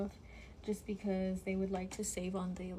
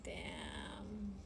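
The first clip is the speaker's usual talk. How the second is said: mumbled whisper; 10 dB quieter than usual. Retold or imitated. Boyfriend mumbling back.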